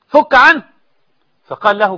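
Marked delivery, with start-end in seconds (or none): 0.1-0.6 high, loud demand; forceful command. Acted. Preacher demanding the caliph free Ahmad, loud command. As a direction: order it out from the pulpit.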